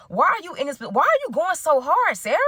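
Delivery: high, swooping incredulous questions; exaggerated exasperated amazement; playful disbelief. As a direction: plead in mock bewilderment. Mock questioning of absent Sarah.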